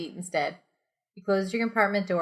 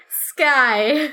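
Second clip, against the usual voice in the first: normal talking voice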